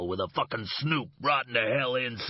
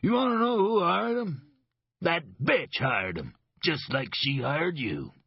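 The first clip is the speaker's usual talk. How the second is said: bitter grin. Acted. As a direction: sneer the secret, then drop into menace. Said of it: "A broad, bitter grin".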